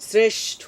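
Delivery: শ্রেষ্ঠ said as sreshtho, dental s first; শ্রেষ্ঠ pronounced deliberately for pupils; careful pronunciation. Shown as a demonstration. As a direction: model শ্রেষ্ঠ slowly, starting with a dental s. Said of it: "শ্রেষ্ঠ উচ্চারণ কি হবে".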